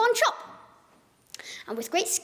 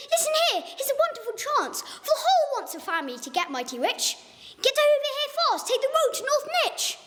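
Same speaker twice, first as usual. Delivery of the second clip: excited pitch; 9 semitones higher than usual. Acted. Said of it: "and I said".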